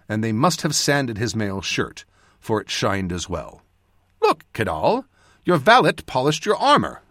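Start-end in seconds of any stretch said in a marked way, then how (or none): 4.2-7.0 higher, animated exclamation; exclaiming. A narrator's reading. Mage shows Cadal his polished armor, exclaiming. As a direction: point it out with raised pitch. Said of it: "I exclaimed".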